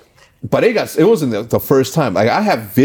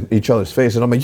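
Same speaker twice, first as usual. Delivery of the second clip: ordinary delivery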